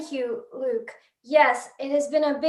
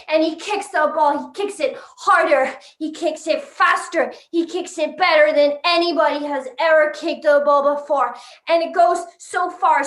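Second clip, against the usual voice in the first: excited mock commentary. Sports reporter describing Ronaldo's record kick, excited and emphatic. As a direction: call the kick like a breathless climax, easing at the end.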